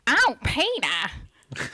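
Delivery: drawn-out, whining cartoon wife calling her husband; Lois Griffin. Asked by friends for her one accent, Lois Griffin impression. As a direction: stretch each word out very slowly in a cartoon voice. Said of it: "and it's Lois Griffin".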